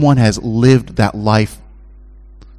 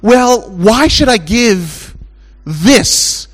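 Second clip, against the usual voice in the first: stingy churchgoer; 10 semitones higher, much slower than usual. Retold or imitated. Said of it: "you're gonna always ask yourself".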